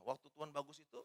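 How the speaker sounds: usual speaking voice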